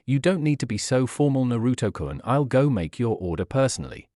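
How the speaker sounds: ordinary delivery, nothing marked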